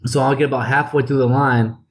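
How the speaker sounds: usual speaking voice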